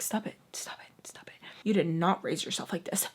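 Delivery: urgent whisper to yourself; whispered self-scolding. Retold or imitated. Telling herself off under her breath.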